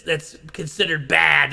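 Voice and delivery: usual speaking voice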